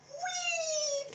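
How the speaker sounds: high, gleeful whee; swinging the backpack at someone, gleeful; playful whee. Shown as a demonstration. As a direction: squeal it as the bag swings. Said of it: "watch this".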